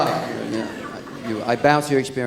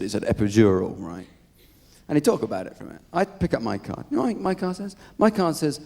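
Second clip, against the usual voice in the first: usual speaking voice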